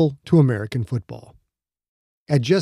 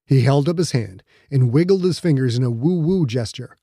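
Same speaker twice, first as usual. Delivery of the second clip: ordinary delivery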